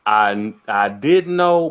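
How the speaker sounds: slow, hesitant rephrasing; hedged, drawn out. Shown as a demonstration. Correcting himself to the interviewer, slow and hedging. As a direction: stretch "know" slowly, low, with a knowing hedge. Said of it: "Let me rephrase that".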